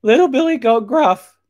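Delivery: very high, loud little-goat squeal; loud squeaky kid goat; little billy goat. A narrator's reading. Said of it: "the goat replied, trying not to breathe".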